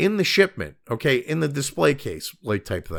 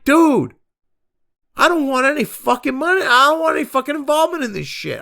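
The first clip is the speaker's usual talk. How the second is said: retold telling-off; 9 semitones higher than usual. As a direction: snap back at the coworker, pitched up. Retelling telling off coworker.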